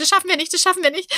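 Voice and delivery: high-pitched, panicky repeated lament; mock wail of an overwhelmed husband; despairing husband. Husband panics about a fourth child, high mock wail.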